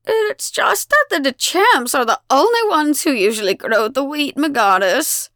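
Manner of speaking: put-on high, loud, wavering old voice; quavering elderly villager. A narrator's reading. An old villager dares address the goddess, quavering. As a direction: quiver like a nervous elder. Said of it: "A weathered sexagenarian with a quivering chin speaks up".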